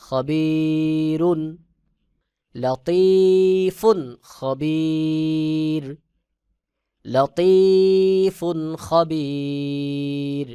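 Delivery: slow melodic tilawat, long held notes; Quran recitation. Shown as a demonstration. Teacher recites the verse melodically for students. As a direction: chant it slowly, sustaining the vowels.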